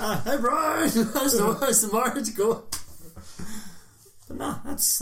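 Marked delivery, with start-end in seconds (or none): 0.0-2.6 mock teasing call. Mock calling to groom Brian.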